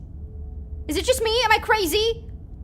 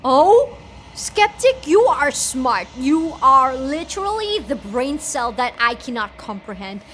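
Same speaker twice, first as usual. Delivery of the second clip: excited praise; 8 dB louder than usual. Real, not acted. Loudly praising a character.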